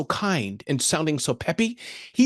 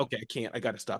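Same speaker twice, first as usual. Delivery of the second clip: much softer, lower resigned mutter; deflated aside; 7 semitones lower, 13 dB quieter than usual. Retold or imitated. [name] imitating himself deflated after the hang-up. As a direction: give up under your breath. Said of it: "I'm like".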